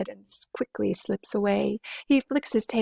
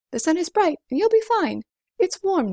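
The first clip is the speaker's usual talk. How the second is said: cheerful lift; 9 semitones higher, 5 dB louder than usual. A narrator's reading. Cheerful reassurance in verse.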